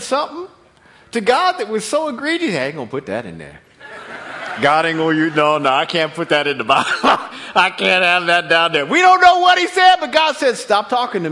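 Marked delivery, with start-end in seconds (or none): 2.5-8.9 laughing Moses. Acted. Moses voiced editing his own book.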